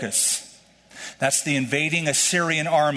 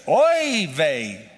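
weary, exasperated oy vey; upset oy vey. Retold or imitated. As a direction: sigh it out like someone fed up. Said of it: "somebody's upset".

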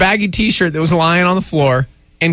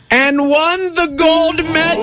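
high, drawn-out triumphant cry; triumphant announcement; 10 semitones higher, slower than usual. Real, not acted. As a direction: build slowly to a shouted climax. Triumphant climax of the story.

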